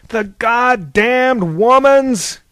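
loud, high, slow bellow; thundering, exasperated curse; furious roar. A narrator's reading. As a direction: roar every word out long. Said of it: "roared Zeus".

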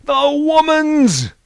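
high, very loud, long-held bellow; furious god bellowing; thunderous roar. A narrator's reading. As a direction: roar it out, stretching every word. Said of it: "roared Zeus".

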